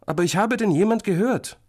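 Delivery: put-on high childish protest; insistent small boy. A narrator's reading. Little brother claims he heard someone, insistent. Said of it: "behauptet Florentin wieder".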